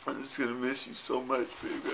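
ordinary talk, nothing marked

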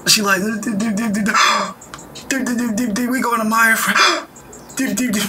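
high breathy gasps between hummed do's; mock gasping. Reacting to her friend, gasping in mock panic. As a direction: gasp for air like the girl in the car. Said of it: "She be gasping for air".

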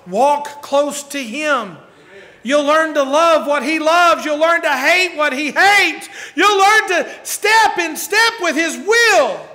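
high, loud, slow pulpit exhortation; fervent preacher at full cry; full-force preaching. Acted. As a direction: drive each line home slowly, building to a shout.

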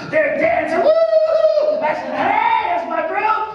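high, whooping excitement; cheering. Retold or imitated. Preacher cheering with the Brazilian section at competitions.

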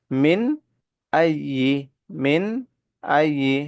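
Arabic মিন আইয়ি recited with the nun clear, no nasal hold; Quran-style clear nun; clear izhar recitation. Shown as a demonstration. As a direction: read মিন আইয়ি with a clean, unnasalized nun. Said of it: "একবারে স্পষ্ট করে পড়বো।".

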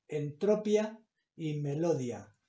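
"entropía y melodia" said as en-TRO-pia y me-LO-dia; misplaced stress. Shown as a demonstration. Teacher showing words without their tilde, misplaced stress. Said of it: "Si no llevara tilde, yo tendría que pronunciar".

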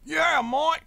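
put-on Australian accent. Joking teammates, said in a loud mock Australian accent. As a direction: cheer it like a mock Aussie bloke. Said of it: "I practice an Australian accent".

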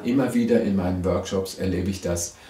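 normal talking voice

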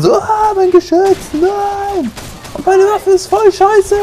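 mock high whiny rage; mimicking an angry gamer whose turret died, high whiny wail; imitating a raging shooter. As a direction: squeal like an outraged loser. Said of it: "Amokläufern wurden immer zerstört".

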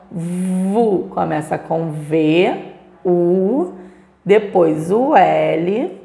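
"vu", "U", "L" said slowly for pupils to copy; slow spelling out. Shown as a demonstration. Teacher spells "vulcão" slowly for the class. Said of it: "vamos escrever aqui".

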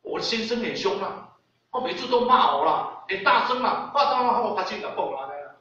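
loud, high-pitched tale of woe; imitated wife's loud complaint; complaining wife. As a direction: act out an aggrieved wife complaining to the doctor. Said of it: "就甲迄医生讲啊".

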